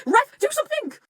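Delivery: high, loud appeal; mock outrage. Fan calling on the ref after a gunshot, outraged. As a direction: call out urgently for intervention.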